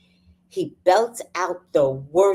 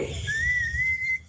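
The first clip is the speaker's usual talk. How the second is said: high laugh; 6 dB quieter than usual. Real, not acted. Laughs at singing.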